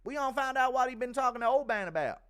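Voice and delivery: high, put-on snooping voice; Happen Ace. Streamer acting someone tapping a phone, high loud caricature. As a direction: pitch it way up and push it. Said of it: "Impression of Happen Ace tapping your phone".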